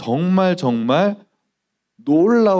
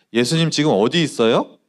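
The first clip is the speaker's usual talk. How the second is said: ordinary talk, nothing marked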